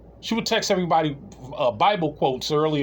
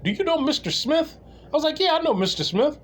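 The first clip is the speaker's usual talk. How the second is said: retold exchange; 12 semitones higher than usual. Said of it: "he was like".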